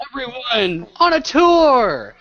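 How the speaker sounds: high, loud, drawn-out announcement; rally the whole group with a big slow shout; calling out. Acted. Tour guide announces the tour.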